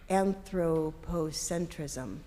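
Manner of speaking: very slow, drawn-out careful word; deliberately slow. Real, not acted. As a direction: say it as slowly as you can. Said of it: "say it as slowly as I could".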